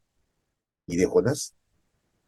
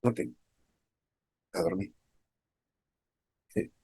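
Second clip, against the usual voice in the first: ordinary delivery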